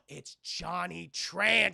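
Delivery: slow, drawn-out name reveal; dramatic reveal. Retold or imitated. Streamer revealing the rival racer to chat, slow and dramatic. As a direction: stretch it like a movie twist.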